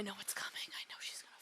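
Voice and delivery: hushed dread; whisper. Real, not acted. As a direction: whisper it so nobody hears. Dreading the coming death.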